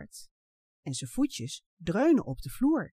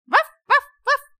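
high, loud woof woof; dog barking; 14 dB louder, slower than usual. A narrator's reading. Takkie the dog bursts in barking, high and loud. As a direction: bark like a small excited dog. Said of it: "roept hij".

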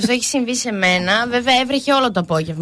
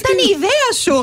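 mock innocent guy; 8 semitones higher, faster than usual. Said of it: "γυρνάει και σου λέει".

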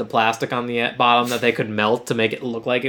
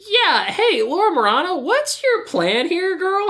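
much higher sassy mock voice, calling her out; 9 semitones higher than usual. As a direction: tease her like a gossiping friend, pitched way up. Mock-scolding the character in play.

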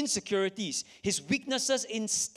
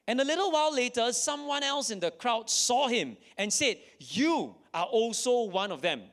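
loud dramatic reading; 6 semitones higher, 3 dB louder than usual. Acted.

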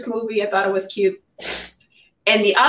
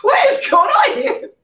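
much higher, louder, laughing outcry; mock disbelief; 12 semitones higher, 4 dB louder, slower than usual. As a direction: throw up your hands in exasperated disbelief. Incredulous reaction to movie plot.

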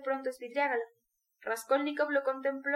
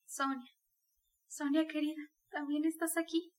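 soft, gentle call of Sonia's name; sweet and tender; 2 semitones higher, 4 dB quieter than usual. A narrator's reading. Dying mother tenderly greets Sonia at her bedside. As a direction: speak to her lovingly, softer. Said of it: "exclamó con acento dulce y tierno".

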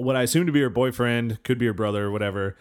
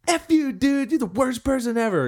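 mock furious girl; 11 semitones higher than usual. The girl curses him out, high and hammed-up.

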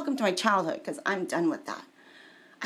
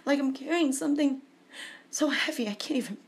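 strained and higher, short of breath; sounding in pain; 4 semitones higher than usual. Real, not acted. Asking listeners what this sounds like, pained and burdened. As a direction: labour through it as under a heavy load. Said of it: "It sounds like I'm in pain".

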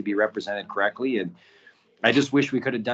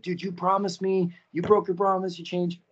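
upset accusation; 8 semitones higher than usual. Retold or imitated. Imagined accusation from the subject.

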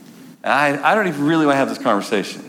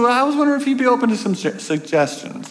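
much higher, quick falsetto lady; a prim church lady, pitched high; sweet woman's voice; 10 semitones higher, faster than usual. Retold or imitated. Woman voiced offering suggestions.

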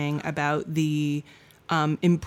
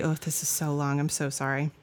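ordinary talk, nothing marked

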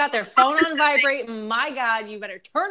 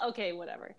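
whispered aside; 13 dB quieter, faster than usual. Real, not acted. Muttered aside to herself.

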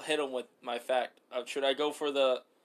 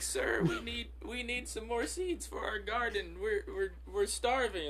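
pleading peasant; 8 semitones higher, 5 dB quieter than usual. Pretend.

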